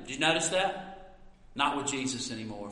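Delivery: ordinary delivery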